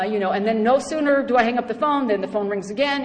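normal talking voice

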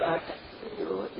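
breathy whisper, nearly voiceless; hushed whisper. Retold or imitated. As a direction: whisper it like a comforting secret. Mother comforting, softly at retreat.

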